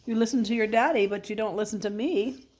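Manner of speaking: usual speaking voice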